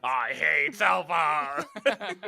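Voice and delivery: put-on cranky old man. Playing old critic, laughing.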